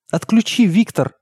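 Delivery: high-pitched plea; narrated plea from a mother, pitch lifted; pleading. A narrator's reading. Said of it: "взмолилась мама".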